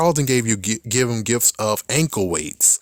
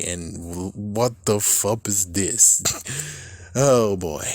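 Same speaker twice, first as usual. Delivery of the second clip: ordinary delivery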